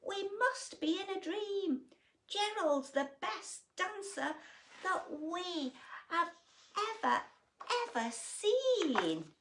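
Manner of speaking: very high loud cry of wonder settling; amazed shouting. Acted. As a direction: cheer in amazement. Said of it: "They shouted".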